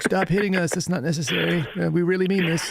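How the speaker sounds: high, meek protest; meek Quaker plea. Retold or imitated. Quakers politely asking bullies to stop, in a high, meek voice. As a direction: plead mildly, too polite to fight back.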